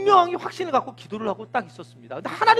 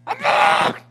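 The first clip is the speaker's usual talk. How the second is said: much higher mock yell; snapping in a temper; 13 semitones higher, 4 dB louder, much slower than usual. Servant snapping at Elijah in a temper, mock yell. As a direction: bark it back fed up. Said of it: "성질 나가지고 확 하는 거예요".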